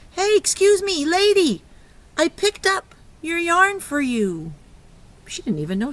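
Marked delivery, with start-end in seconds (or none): 0.1-4.5 higher, louder cheerful call to the lady; polite gentleman. Acted. Gentleman returning yarn to grandma, friendly higher call. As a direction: call out kindly to get her attention. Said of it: "He's saying".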